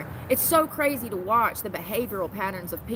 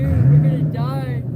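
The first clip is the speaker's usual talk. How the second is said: low, loud despairing wail; 6 semitones lower, 11 dB louder, slower than usual. Real, not acted. Freezing, despairing on a bench.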